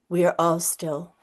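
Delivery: plain reading voice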